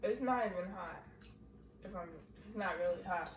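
chewing while talking; talking between bites; mouth full of pizza. Real, not acted. Seen 0: Child holding pizza speaks between bites, jaw working, mouth partly full.

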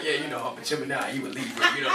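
low, hushed smooth-talker imitation; imitating Tory. Tory chatting up a female, low mock-suave voice. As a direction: drop low and mumble a pick-up line. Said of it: "yo, he be like".

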